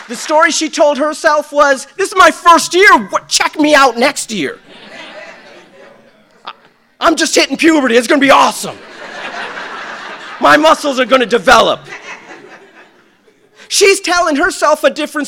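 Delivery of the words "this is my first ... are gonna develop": put-on loud, laughing teen bravado; girl's confident self-talk. Girl boasting to herself, loud laughing bravado. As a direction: puff up like a proud kid.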